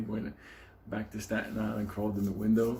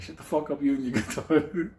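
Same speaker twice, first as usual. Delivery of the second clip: mock yell; 6 semitones higher, 5 dB louder than usual. Ben yelling at cellmate.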